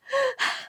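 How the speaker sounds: acted panicked gasps, high; mock panicky panting; panicked breathing. Out-of-breath heroine leaning on the door, panicked gasping. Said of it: "panicking and breathing".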